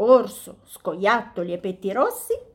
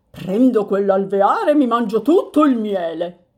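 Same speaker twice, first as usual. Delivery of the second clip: louder, gruff greedy bear; 6 dB louder than usual. Acted. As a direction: grab it all, hungry.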